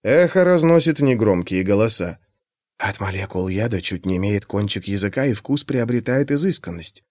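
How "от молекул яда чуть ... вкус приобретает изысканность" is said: soft, far-off advert; distant ad voice. A narrator's reading. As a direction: overheard announcement from afar. Overheard ad voice on street. (далёкий рекламный голос)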